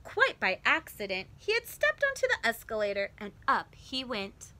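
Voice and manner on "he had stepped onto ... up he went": ordinary delivery, nothing marked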